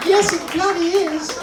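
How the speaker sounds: very high, slow, irate wife puppet; exaggerated high female indignation; feminine voice. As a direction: squeal the retort as the lady dummy. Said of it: "let's find a nice feminine voice".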